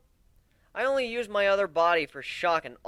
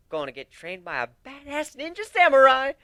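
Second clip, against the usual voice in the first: much higher, louder gleeful burst; excited; 10 semitones higher, 10 dB louder than usual. Acted. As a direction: squeal with delight at the idea. Thrilled at samurai training.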